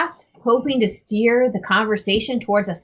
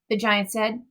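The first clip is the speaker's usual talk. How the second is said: plain reading voice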